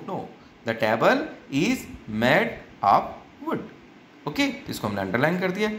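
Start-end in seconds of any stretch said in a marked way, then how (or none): 0.7-3.6 slow, spaced read-aloud with "wood" kept short; short vowel in wood. Shown as a demonstration. Teacher reading the corrected sentence, slow with short wood. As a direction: read word by word, end on a brief wood. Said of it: "ऐसा नहीं wood".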